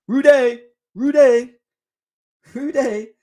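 loud, drawn-out call of a name; shouting a name. Acted. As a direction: yell it like calling across the pitch. Calling out the player's name.

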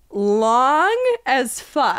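"long as fuck" said very slowly, each word stretched; drawn out. Real, not acted. Stressing how long it is.

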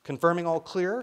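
high, loud radio check; young woman on radio. A narrator's reading. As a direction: call it out over a radio, lifting at the end. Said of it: "came the young woman's voice".

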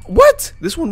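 very high, loud yelp; incredulous. Real, not acted. Gamer finding a closed window reopened, incredulous yelp. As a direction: squeak it out in disbelief. Finds window open again.

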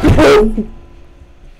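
loud, high burst of fright; sudden jump-scare cry; real scream cut loose by a scare; startled yelp. Real, not acted. Jump scare cuts off remark.

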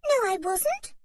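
put-on high, defensive little-girl denial; protest, flustered; indignant girl. A narrator's reading. Anne denies it to Dick, high and indignant. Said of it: "going red".

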